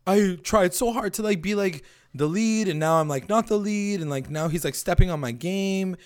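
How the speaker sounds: imitating a whiny teen complaining; whiny excuse. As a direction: whine like a sulky kid. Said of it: "He basically says".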